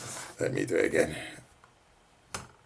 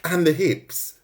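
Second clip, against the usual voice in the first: mock viewer request; 7 semitones higher, slower than usual. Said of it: "She's gonna say".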